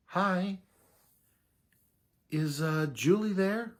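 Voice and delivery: put-on a young man nervously asking for his date on the phone; nervous high voice. Young man phoning a girl's parents, nervously high-pitched. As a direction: pitch jumps up, nervous and unsure. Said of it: "Hear that larynx go up?".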